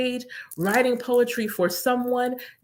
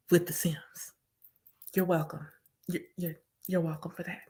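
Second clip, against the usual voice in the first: whisper; 3 semitones lower, 8 dB quieter than usual. Acted. Sharing the Sims tip with viewers in a whisper.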